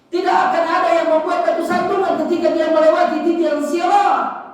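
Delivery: high, forceful sermon voice; ringing sermon delivery at full force; impassioned preaching. Acted. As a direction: preach it out, pitched high and driven.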